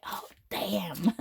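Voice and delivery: hushed, dismayed whisper; whispered oh damn. Retold or imitated. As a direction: breathe it out like a caught secret. Retold dismay at confirmation.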